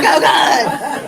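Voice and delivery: loud, half-laughing yelp; re-enacted cry of alarm at a spider; comic fright. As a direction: yell it in mock horror.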